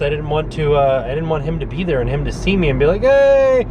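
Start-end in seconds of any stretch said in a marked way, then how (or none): 3.0-3.7 high, loud imitated hail; mock an over-friendly shout of hello; cashier's eager greeting. Cashier spotting a customer he likes, imitated high and loud.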